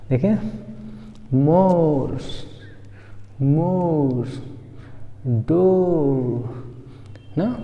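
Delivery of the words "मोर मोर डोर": मोर and डोर heavily stretched; slow word-by-word demo of मोर, डोर; long o vowel. Shown as a demonstration. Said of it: "उसी तरह ओ".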